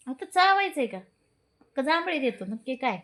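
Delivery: high, loud scold. Real, not acted. Sister scolding the yawning dog, loud and high. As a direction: snap at the dog.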